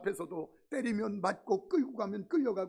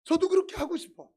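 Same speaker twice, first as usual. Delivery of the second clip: louder and higher, wrenching out 그렇게 as if writhing; anguished outburst; 8 semitones higher, 8 dB louder than usual. Shown as a demonstration. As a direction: cry out 그렇게 like someone struggling against their limits. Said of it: "막 몸부림치면서 하고 싶은데".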